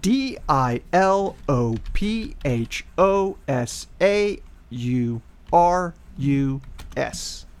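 slow, careful letter by letter; spelling slowly. Acted. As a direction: spell it out like a bee.

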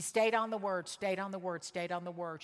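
stayed on the word, repeated and fading down; fading repetition. Real, not acted. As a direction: let the refrain fall away. Preacher repeats the phrase for emphasis.

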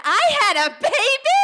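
very high, loud, rising disbelief; astonished. Retold or imitated. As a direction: shriek it in stunned joy. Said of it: "I said".

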